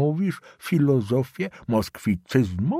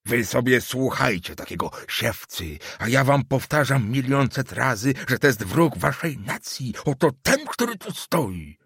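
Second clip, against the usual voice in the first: put-on higher, vehement old man swearing an oath; old councillor denouncing the student, higher and vehement; 6 semitones higher than usual. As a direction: denounce the boy to the family.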